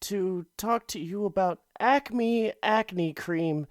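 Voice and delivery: high, shaky-voiced pitchman falling apart; nervous intern ad read. Intern begging listeners to buy acne cream, high and panicky. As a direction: plead through a botched commercial. Acted Richard Scary ad skit.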